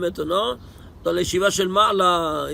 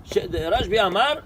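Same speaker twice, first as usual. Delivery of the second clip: normal talking voice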